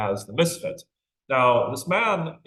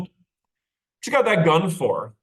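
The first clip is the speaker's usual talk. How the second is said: put-on child's question; 7 semitones higher, 6 dB louder, slower than usual. A narrator's reading. Boy questions the gunman.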